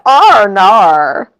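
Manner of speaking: put-on "oh no" drawn out in broad Aussie accent. Co-host showing a favourite Australian saying, drawn-out Aussie accent. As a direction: stretch "Arnar" slowly in a put-on Aussie accent. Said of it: "That's my favorite thing that Australian people say".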